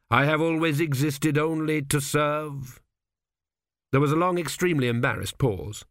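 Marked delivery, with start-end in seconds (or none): 0.1-2.8 higher, slow, sustained declaration; solemn pledge. A narrator's reading. Kneeling priest to the dead kings, solemn pledge. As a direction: intone it slowly like an oath.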